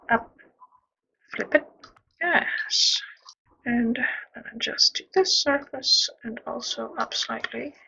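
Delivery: ordinary delivery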